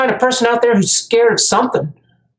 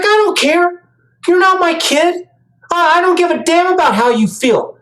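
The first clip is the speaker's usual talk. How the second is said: pitched-up contemptuous jeer ending in a laugh; sneering mock reply; 10 semitones higher than usual. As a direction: brush them off with scornful contempt. Mocking rant at whiners.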